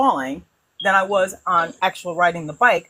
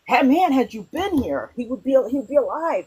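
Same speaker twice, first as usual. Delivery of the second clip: higher, hammed-up complaining townsfolk; mock accusing crowd; 8 semitones higher than usual. Townspeople blaming Jesus, mock accusing voice. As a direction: whine like the grieving town blaming Jesus. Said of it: "everybody was like".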